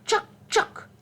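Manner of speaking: high, sharp squirrel chucks; squirrel chatter. A narrator's reading. Teacher showing children what mama squirrel says, high squirrel chatter. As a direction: squeak it out in quick separate bursts. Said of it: "The mama squirrel says".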